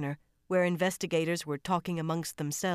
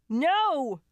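loud, much higher scream; 10 semitones higher, 7 dB louder than usual. A narrator's reading. Lucy screaming as she is pulled away, shrieked. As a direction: shriek it, desperate. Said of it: "Lucy screamed".

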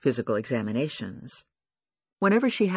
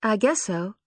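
put-on insecure young woman; 7 semitones higher than usual. Lucy voiced, acting insecure.

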